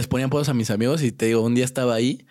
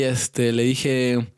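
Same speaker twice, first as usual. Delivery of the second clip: ordinary delivery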